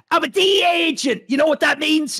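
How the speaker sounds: mock DEA agent bravado; DEA agent quote. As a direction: play the cocky agent threatening. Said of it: "and goes".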